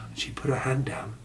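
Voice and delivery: usual speaking voice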